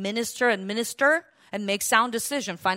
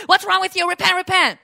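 Pharisaic scolding; 6 semitones higher, 7 dB louder than usual. Retold or imitated. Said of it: "judgy and, like, Pharisaic".

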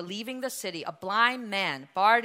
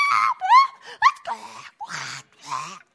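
panicked screaming; 7 dB louder, slower than usual. Retold or imitated. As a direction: play the frightened disciples screaming on the boat. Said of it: "They're going".

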